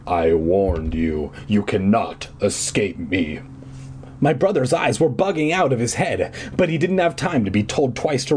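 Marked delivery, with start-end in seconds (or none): 0.1-3.4 deep, slow warning; low and heavy; booming villain. A narrator's reading. Caped man warns the fleeing children. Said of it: "booming voice".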